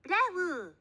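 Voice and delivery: high loud mock bark; dog bark. A narrator's reading. Dog Zeke barks.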